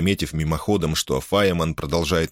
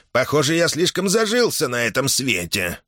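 put-on higher-pitched, lightly nasal old man's whine; 8 semitones higher than usual. A narrator's reading. Old Hoxi complains to the captains, lightly nasal. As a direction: voice a hint of an old man talking through his nose. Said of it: "прогнусавил старый Хокси".

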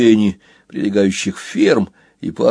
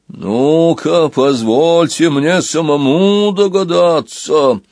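booming giant voice; 4 semitones higher, slower than usual. Acted. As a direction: thunder it out like a huge bell. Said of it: "прогремел голос, вибрируя точно исполинский колокол".

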